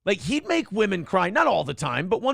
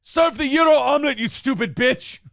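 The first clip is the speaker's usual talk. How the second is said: berating boss; 8 semitones higher, 5 dB louder than usual. Retold or imitated. Mimicking the owner ordering a woman to serve omelets, angry shout. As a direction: bellow insults and orders in imitation of a tyrant boss. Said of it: "if a girl fucked up, he'd be like".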